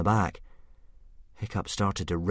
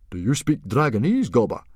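higher, louder astonished question; surprised; 5 semitones higher, 6 dB louder than usual. A narrator's reading. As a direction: caught off guard by the offer. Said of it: "asked Stoic in surprise".

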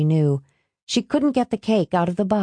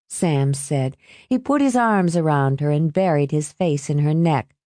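ordinary delivery

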